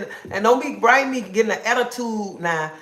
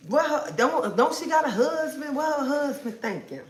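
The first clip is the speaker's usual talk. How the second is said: higher, questioning nosy viewer voice; mock nosy viewer; 7 semitones higher than usual. Anticipating viewers' judgment, higher mock nosy voice. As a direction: ape a judgmental commenter, pitched up. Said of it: "Before anybody say".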